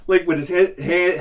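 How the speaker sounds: usual speaking voice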